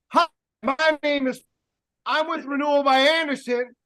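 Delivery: stilted, high-pitched hello with a gap midway; weirdo greeting. Shown as a demonstration. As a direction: imitate a hopelessly awkward door-to-door rep. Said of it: "If you show up at the door and you're like".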